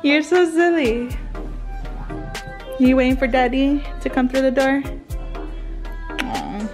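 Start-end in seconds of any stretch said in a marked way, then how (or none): none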